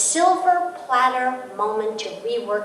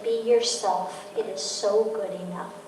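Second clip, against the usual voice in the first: tender mother; 3 semitones lower, 7 dB quieter than usual. Retold or imitated. Mother softly reassuring her daughter before the dance. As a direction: gently reassure your daughter. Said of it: "And the daughter wept".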